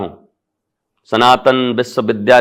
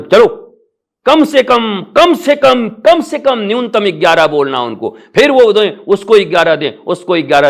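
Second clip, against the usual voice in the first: much higher, pleading कम से कम climbing each time; excited haggling; 11 semitones higher, 3 dB louder than usual. Retold or imitated. Guru retelling haggling with a follower, excited. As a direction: coax him down, pitch rising on every repeat.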